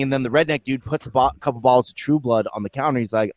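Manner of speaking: ordinary talk, nothing marked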